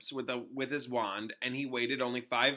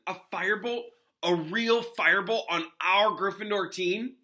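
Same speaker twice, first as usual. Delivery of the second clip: amazed excitement; 7 semitones higher, 5 dB louder than usual. Acted. Ron amazed at firebolt.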